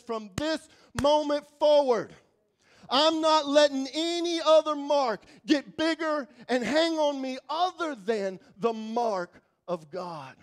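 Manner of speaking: loud, slow, firm proclamation; bold declaration. Shown as a demonstration. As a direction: declare it over yourself with force. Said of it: "you just make a declaration".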